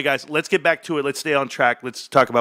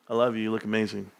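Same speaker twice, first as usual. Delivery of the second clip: ordinary talk, nothing marked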